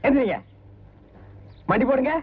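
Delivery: ordinary talk, nothing marked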